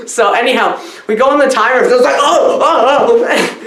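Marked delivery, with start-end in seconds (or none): 2.2-3.6 high, laughing mock tremble; mock shaking. Vlogger mimics the shaking actors, laughing and high. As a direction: imitate the actors shaking in the rift. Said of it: "I'm doing this because they were shaking".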